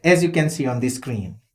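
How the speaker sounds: ordinary talk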